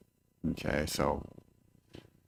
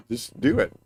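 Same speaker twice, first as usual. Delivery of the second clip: ordinary talk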